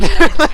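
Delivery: loud laugh while chased. Real, not acted. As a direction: burst of high loud laughter.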